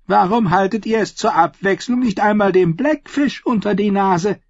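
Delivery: high, loud mocking question; mock the old man's paper; sneering nephew. Edwin mocking his uncle before the council, high and loud.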